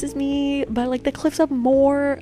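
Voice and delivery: high-pitched mock bragging; high, self-mocking boast; showing off. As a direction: imitate yourself proudly showing off holiday pictures. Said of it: "I'm, like, flexing".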